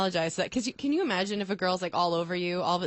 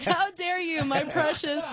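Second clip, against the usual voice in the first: much higher, precious-clutching outrage; mock Gollum-ish indignation; 9 semitones higher, slower than usual. Joking about the stranger, a much higher mock outrage. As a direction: hiss out a hammed-up precious. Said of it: "And I'm like".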